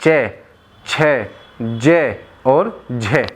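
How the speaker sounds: चै, छे, जे, झे drawn out slowly from the palate; slow syllable drill. Shown as a demonstration. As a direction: sound out चै, छे, जे, झे one by one. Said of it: "और बोलिए". (तालु से धीरे धीरे चै, छे, जे, झे)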